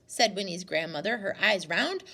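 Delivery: plain reading voice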